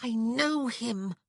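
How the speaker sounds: high, slow, heated insistence; hot, urgent emphasis on "know". A narrator's reading. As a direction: insist on it hotly, drawing out KNOW. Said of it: "she whispered hotly".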